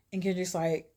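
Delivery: plain reading voice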